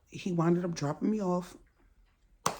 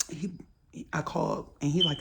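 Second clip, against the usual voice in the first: usual speaking voice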